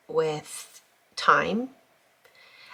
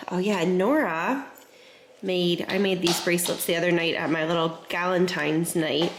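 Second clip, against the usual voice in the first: ordinary delivery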